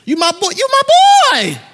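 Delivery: high, loud buddy greeting to Jesus; casual Peter. Acted. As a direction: play Peter as a brash pal.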